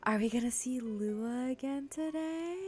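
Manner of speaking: high, drawn-out excited squeal; rising delight, words stretched out; gushing excitement. Real, not acted. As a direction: gush over someone you adore. Gushing over a favourite character.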